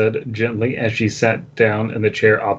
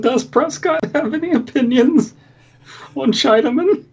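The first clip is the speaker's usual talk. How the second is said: high voice. Acted. High joking voice.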